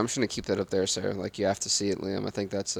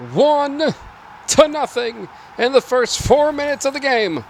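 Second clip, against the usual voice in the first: much higher, louder broadcast call of the penalty; play-by-play announcer; 10 semitones higher, 4 dB louder than usual. Acted. Narrating the penalty goal for viewers, excited broadcast voice. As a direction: call the goal like live TV commentary.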